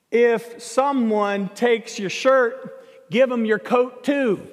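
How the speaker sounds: slow, deliberate scripture quote; weighty, drawn-out reading with pauses; pointed verse reading. Retold or imitated. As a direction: spell out the verse word by word.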